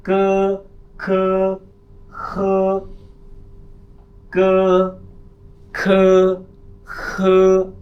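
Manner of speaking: क and ख called out high and loud in turn; letter drill. Shown as a demonstration. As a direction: drill the two letters sharply. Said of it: "क्या पढ़ेंगे". (क और ख ऊँची आवाज़ में दोहराना)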